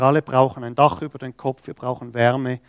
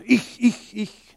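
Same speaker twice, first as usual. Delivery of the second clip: self-important me me me; 6 semitones higher, 4 dB louder, slower than usual. Retold or imitated. Mocking a self-important volunteer.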